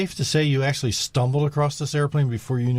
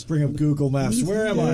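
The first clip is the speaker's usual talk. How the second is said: mock lost pilot; 4 semitones higher, 4 dB louder than usual.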